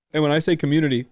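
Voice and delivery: talking through hiccups; hiccuping mid-sentence. Real, not acted. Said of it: "I got the hiccups now".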